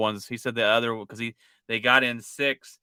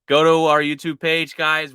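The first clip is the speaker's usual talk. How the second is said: promo outro; 5 semitones higher, 9 dB louder than usual. Real, not acted.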